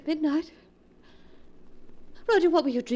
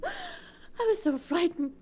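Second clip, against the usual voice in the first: frightened; 5 semitones higher, 5 dB quieter, much slower than usual. Acted. Shaken wife after panic.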